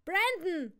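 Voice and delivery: loud, high call of a name. Acted. Father summons Brandon without looking away, calling out. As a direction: call him from another room. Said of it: "rief mein Vater".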